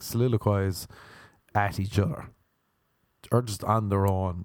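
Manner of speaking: normal talking voice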